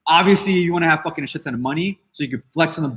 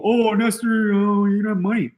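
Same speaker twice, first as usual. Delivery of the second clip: higher-pitched mock taunt; put-on teasing voice for the haters; mocking critics; 4 semitones higher than usual. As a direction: imitate a whining critic, making fun of them. Mimics critics taunting him about money.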